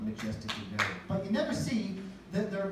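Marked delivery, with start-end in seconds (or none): 0.0-1.0 deep, hushed wildlife-film narration; nature documentary narrator. Retold or imitated. Comedian mocking nature documentaries, deep narrator voice. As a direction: lower voice, savour the swamp. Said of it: "you always see on nature documentaries like".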